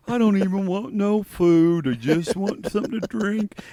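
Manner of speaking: dog voice. Pretend. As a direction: beg like a thirsty dog. Dog voiced, high far.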